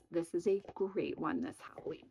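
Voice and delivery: ordinary talk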